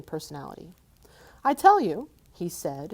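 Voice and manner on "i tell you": much higher, louder hobbit insisting; insistent hobbit. Acted. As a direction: stand up to the dragon. Bilbo insisting.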